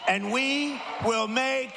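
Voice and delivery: high, sweeping closing oration building to shouts; rally finale. Real, not acted. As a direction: lift the crowd with a grand finish.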